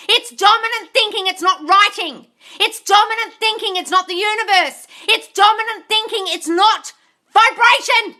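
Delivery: chanting the contrast, hitting dominant each time; emphatic repetition. Real, not acted. Ranting at followers, stressing each dominant thinking. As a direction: hammer each It's dominant thinking, then it's not.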